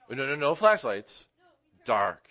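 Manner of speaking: emphatic. Real, not acted. Recalling basement with no light.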